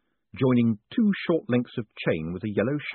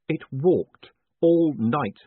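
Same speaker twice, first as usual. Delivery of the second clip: exasperated complaint; 6 semitones higher, 5 dB louder, much slower than usual. Acted. Exhausted complaint.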